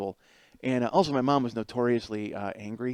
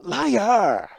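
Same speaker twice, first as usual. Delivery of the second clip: high, loud, falling shout; accusing yell; 9 semitones higher, 8 dB louder than usual. Retold or imitated. Brother answers little brother's soup praise, accusing shout. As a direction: cut him down with a yell.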